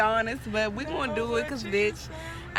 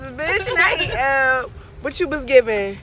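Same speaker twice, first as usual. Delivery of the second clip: loud, hyped outburst; excited shout; 3 semitones higher, 9 dB louder than usual. Real, not acted. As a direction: belt it out excitedly. Excited shout about eating.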